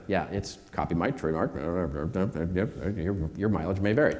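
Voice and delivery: low, soft throwaway fine print; mumbled mock disclaimer. Speaker tossing the audience a joke trademark disclaimer, mumbled low. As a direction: mumble through the legal small print as a joke.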